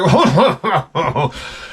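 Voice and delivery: mock Humongous chuckle; a short menacing laugh; warlord laugh. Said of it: "Humongous is like".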